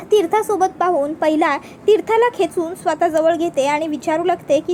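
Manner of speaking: usual speaking voice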